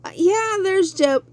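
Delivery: a hint of an offhand shrug of a God; mock unimpressed God, tossed off high; bored God. Preacher mocks a bored God, lightly high. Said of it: "God wasn't up in heaven be like".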